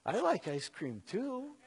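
put-on playful Texas Southern accent, slowed down; sweet Southern belle. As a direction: coax him like a sweet Dallas belle. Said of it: "Southern belle, sweet accent".

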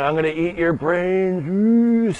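a hammed-up zombie chasing a toddler; playful zombie. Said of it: "I pretend like I'm a zombie".

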